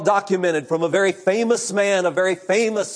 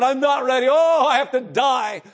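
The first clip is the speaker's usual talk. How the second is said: despairing cry; 5 semitones higher than usual. Retold or imitated. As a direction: cry out abandoned and terrified. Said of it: "He said".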